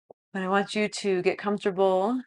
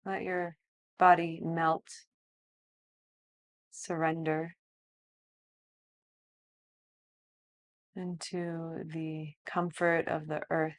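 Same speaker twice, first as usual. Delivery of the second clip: soft, slow meditation voice; guided meditation; 4 dB quieter, slower than usual. Acted. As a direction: guide them gently into stillness. Leads breathwork and relaxation.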